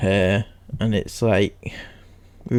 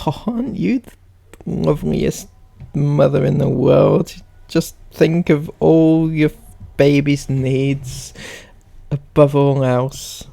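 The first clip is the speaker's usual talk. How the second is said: sarcastic gushing praise; mock admiration; 4 semitones higher than usual. Commenting on Sarah's motherhood post, mock admiration. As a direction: lay on the fake admiration. Mocks the poster as perfect mother.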